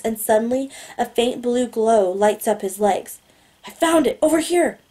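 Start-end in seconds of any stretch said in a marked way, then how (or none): 3.7-4.8 higher, eager voice, not truly whispered; excited. A narrator's reading. Boy who just found the Lorelite, excited. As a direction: call it out with hushed-up excitement. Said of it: "he whispers excitedly".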